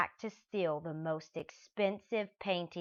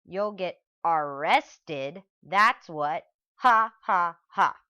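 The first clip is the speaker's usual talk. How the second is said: loud, slow gloat then ha ha ha; gloating loud laugh; 10 dB louder, slower than usual. Acted. Mister Dart mocking the arrested thieves, loud gloating. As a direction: crow over the caught thieves.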